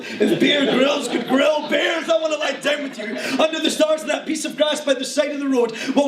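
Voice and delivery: urgent poetry recital, easing down at the close; performance poem. Acted. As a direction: race and stretch the tongue twisters as slam verse. Performs slam poem full of tongue twisters.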